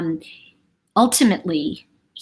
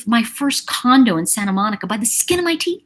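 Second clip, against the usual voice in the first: ordinary talk, nothing marked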